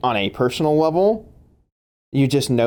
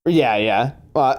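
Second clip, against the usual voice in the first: ordinary delivery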